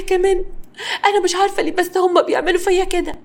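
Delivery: high-pitched, wheedling complaint; milk his sympathy, voice pitched high; put-on aggrieved pleading; playing the victim. A narrator's reading. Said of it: "وحاولت تلعب على الحتة دي".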